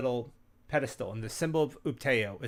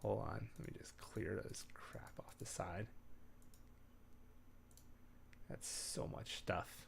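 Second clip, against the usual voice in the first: whisper; 5 semitones lower, 19 dB quieter than usual. Real, not acted. Muttering to himself off mic.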